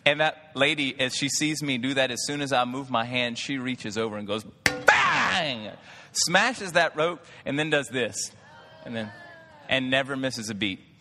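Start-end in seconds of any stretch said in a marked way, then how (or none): 4.8-5.7 sharp, higher, louder bang; loud mimic of the roach being smashed; imitated smack. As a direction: slap the word out like a hand hitting the counter.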